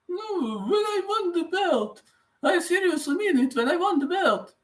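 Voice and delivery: very high squeaky put-on voice; Leon Edwards impression. Streamer copying a fighter after winning the belt, high-pitched impression. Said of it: "But Leon Edwards, mm, after winning the belt".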